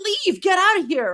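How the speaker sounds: mimicking Iranians yelling him out; mock angry shout. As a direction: chase him off in a put-on voice. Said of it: "and they're like".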